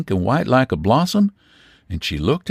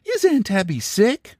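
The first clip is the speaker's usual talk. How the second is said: aggrieved and injured; 8 semitones higher than usual. A narrator's reading. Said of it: "sort of aggrieved and injured".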